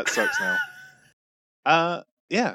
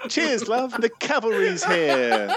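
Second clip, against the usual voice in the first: high-pitched British impression, drawn out; hammed-up British catchphrase, high and slow; Tracer; 9 semitones higher, slower than usual. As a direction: do a squeaky British heroine, amused. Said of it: "Tracer impressions with me, and she is British".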